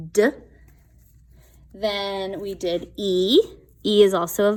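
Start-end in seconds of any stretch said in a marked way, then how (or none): 0.1-0.3 "d" as a short voiced stop; letter sound d. Shown as a demonstration. Teacher showing kids what D says, a short "d". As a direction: give just the "d" sound. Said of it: "D says".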